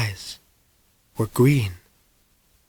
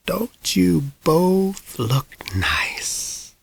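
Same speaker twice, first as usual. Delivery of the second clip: put-on breathy whispered old lady's voice; slow, frail whisper of a very old woman; palsied old woman; 5 semitones higher, 5 dB quieter, much slower than usual. A narrator's reading. Ancient lady greeting mother and son, frail whisper. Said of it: "she said in her palsied voice".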